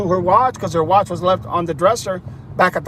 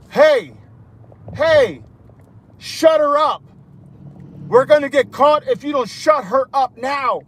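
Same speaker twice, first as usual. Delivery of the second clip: mock urgent driver; 6 semitones higher than usual. Driver ordering silence in back.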